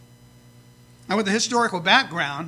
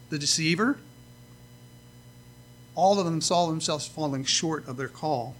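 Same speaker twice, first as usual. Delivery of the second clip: ordinary talk, nothing marked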